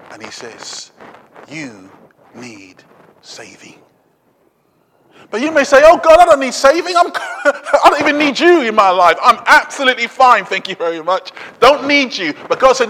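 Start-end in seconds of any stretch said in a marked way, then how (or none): 1.5-3.8 low, very soft and slow; hushed, deep pronouncement; grave divine verdict. Acted. Voices God looking down on us. 5.9-12.3 loud, higher-pitched brush-off with a laugh; hammed-up self-sufficient protest; mock dismissive retort. Acts out a person brushing God off.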